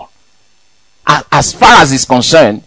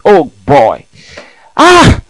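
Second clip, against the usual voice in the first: ordinary talk, nothing marked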